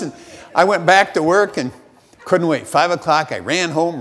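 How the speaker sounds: normal talking voice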